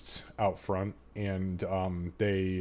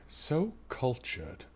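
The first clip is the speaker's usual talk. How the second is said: slow, softer, higher priestess aside; wry high priestess; 4 semitones higher, 6 dB quieter, much slower than usual. Acted. Priestess turning on her heels, slow wry aside. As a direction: draw it out quietly with a lifted pitch. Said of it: "you hear her say".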